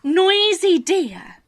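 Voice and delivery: plain reading voice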